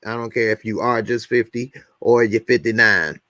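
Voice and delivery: ordinary delivery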